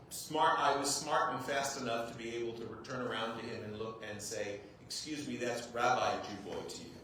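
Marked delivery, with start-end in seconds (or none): none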